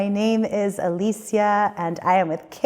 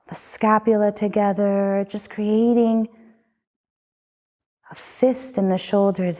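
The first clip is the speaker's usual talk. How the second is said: guided meditation. Acted. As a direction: lead them gently through the body. Leads a guided body scan.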